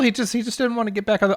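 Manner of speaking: ordinary talk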